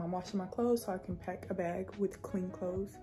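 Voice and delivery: ordinary talk, nothing marked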